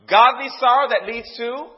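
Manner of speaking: loud, emphatic sermon delivery; full-force preaching. Real, not acted. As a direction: drive each point home to the congregation.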